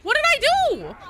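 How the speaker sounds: shocked outcry. Real, not acted. Streamer suddenly punished in game, loud high indignant outcry. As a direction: cry out in baffled protest.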